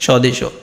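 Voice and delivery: স্বদেশও said "sho-de-sho"; added final vowel. Shown as a demonstration. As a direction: finish স্বদেশও on an open o. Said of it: "কী উচ্চারণ হবে?".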